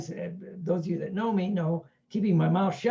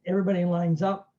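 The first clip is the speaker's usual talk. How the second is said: usual speaking voice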